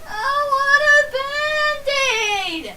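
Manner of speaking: put-on high, slow, wailing sob of a small child; imitated crying. Hurt little boy to his mom, begging for a Band-Aid, high wailing sob. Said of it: "And I was still crying".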